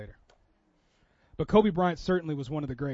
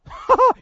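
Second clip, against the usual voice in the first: burst of laughter; 11 dB louder than usual. Real, not acted. Laughs at own joke.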